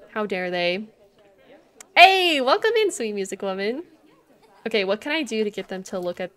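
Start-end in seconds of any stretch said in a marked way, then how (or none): 1.9-3.8 loud, high greeting; excited welcome. Real, not acted. Greeting a new viewer, loud and cheerful. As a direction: call the welcome out.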